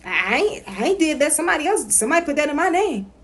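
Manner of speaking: high, defensive protest; mock defensive denial. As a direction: play the indignant denier. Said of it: "It's not about".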